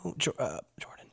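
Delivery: soft, hesitant half-whisper; hushed, halting approach. Acted. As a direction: whisper a tentative greeting. Character joins the whispered eavesdropping.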